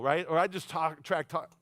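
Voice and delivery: ordinary talk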